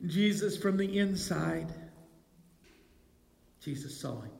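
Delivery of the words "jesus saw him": dropped very low and soft, almost breathed; hushed. Acted. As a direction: let it fall to a hush. Preacher's hushed emphasis on seeing.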